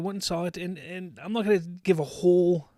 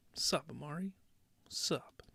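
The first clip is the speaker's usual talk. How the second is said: breathy whispered greeting; 10 dB quieter than usual. Pretend. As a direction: hush it, all breath. Whispered greeting to chat viewer.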